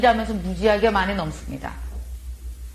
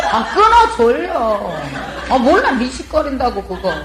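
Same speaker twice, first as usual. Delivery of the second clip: mock cranky snap; 4 dB louder, slower than usual. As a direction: play the cranky pregnant wife snapping at her husband. Acting irritable pregnant wife on phone. (남편에게 짜증 내는 임신한 아내, 높고 큰 소리로 웃으며 투덜댐)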